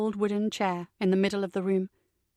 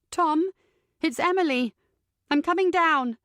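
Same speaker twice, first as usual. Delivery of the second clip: higher, louder call down the stairs; 6 semitones higher, 4 dB louder than usual. A narrator's reading. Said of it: "I call down the stairs".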